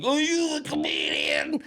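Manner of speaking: mocking the drunk guy at the bar; hammered guy. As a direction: slur it like a hammered stranger.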